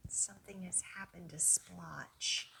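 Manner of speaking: hushed storybook whisper. A narrator's reading. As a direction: lean in and whisper the twist. Suspense moment in read-aloud.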